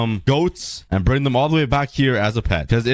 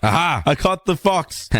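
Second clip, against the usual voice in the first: usual speaking voice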